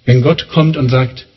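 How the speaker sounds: normal talking voice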